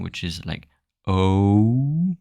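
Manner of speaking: slow gliding English "O" diphthong; stretched "O" with the mouth moving. Shown as a demonstration. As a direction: let the "O" slide from one vowel to another. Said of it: "you usually have a diphthong".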